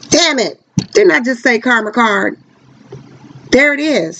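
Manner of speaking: sudden high surprised outburst. Real, not acted. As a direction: caught off guard, voice jumps high. Card confirms her karma guess.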